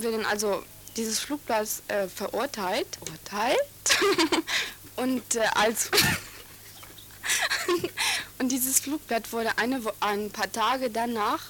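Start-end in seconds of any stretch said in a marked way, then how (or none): 3.6-4.7 brief amused chuckle; short laugh. Real, not acted. Student stumbles over a word, short embarrassed laugh. Laughs after verbal slip. 7.2-8.3 high little giggle; can't hold the giggle back. Real, not acted. Student recalls the flyer affair, high giggle.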